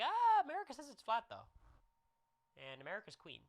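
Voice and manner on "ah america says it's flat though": high, loud smug teasing voice; mocking America. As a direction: gloat like the whole country is on your side. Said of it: "you're gonna be like".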